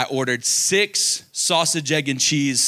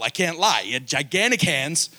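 ordinary talk

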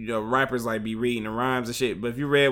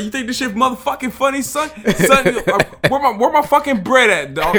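high, loud, mock-menacing street voice; imitating an angry New York robber; 12 semitones higher, 9 dB louder than usual. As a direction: play the robber demanding his money, hammed up. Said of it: "He's like".

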